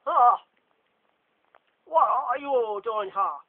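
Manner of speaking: loud, high, slow booming voice; Lockhart's shout. Acted. Lockhart bursting into the common room, booming shout. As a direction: boom it into the room.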